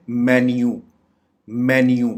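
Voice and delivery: "मेन्यू" said MEN-yoo; short e and a y glide in "मेन्यू"; correct pronunciation. Shown as a demonstration. Teacher giving the correct form, "मेन्यू" as MEN-yoo. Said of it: "उसको हम बोलेंगे".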